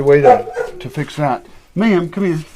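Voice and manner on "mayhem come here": louder, higher calling of the dog. Real, not acted. Owner calling Mayhem, then scolding loudly.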